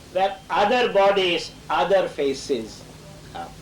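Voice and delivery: lifted, measured verse reading with long pauses; poem recitation. Shown as a demonstration. Reciting Savitri poem lines.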